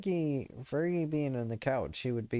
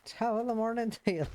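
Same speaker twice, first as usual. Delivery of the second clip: much higher mock Irish greeting; Irish accent; 11 semitones higher, faster than usual. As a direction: ham up a stock Irish accent. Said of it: "And then he's like".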